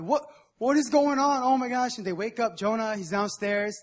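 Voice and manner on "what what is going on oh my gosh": higher, louder mock panic; panicked sailors. Sailors in the storm crying out, mock panic. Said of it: "they're all like".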